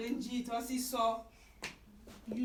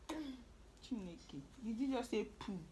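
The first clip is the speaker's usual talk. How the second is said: ordinary delivery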